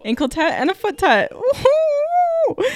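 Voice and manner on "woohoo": high, drawn-out cheer; celebratory whoop. Real, not acted. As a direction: whoop over the shared discovery. Celebrating matching tattoos.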